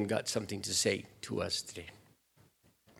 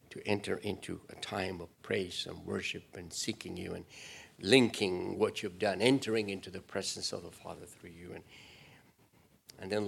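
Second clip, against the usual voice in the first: humble worship prayer; 2 semitones lower than usual. Acted.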